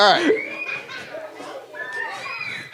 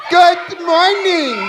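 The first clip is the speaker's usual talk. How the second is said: loud; 7 semitones higher, much slower than usual. Shown as a demonstration. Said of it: "We're gonna do it really loud".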